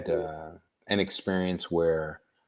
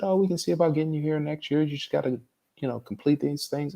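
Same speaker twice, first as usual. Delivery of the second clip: higher, quicker imitation of the official; admissions head's voice; 9 semitones higher, faster than usual. Student retelling the head of admissions stalling him, higher quicker imitation. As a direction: play the admissions head brushing him off, fast and high.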